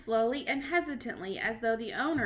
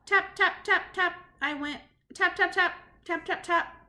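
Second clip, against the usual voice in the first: tapping sounds; 6 semitones higher, 4 dB louder than usual. Acted. Boy taps on door.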